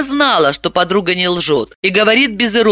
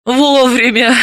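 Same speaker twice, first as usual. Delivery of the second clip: a short bitter laugh on the word; a wry, mirthless chuckle; 6 semitones higher, slower than usual. Acted. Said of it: "горько рассмеялась Далила".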